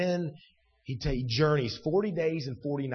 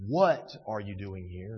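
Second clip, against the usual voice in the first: low, slow question with WHAT punched first; stress on WHAT; 7 semitones lower, slower than usual. Shown as a demonstration. Preacher ruling out a reading, stress on what. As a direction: hit the word what, keep the rest low. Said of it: "that the question isn't".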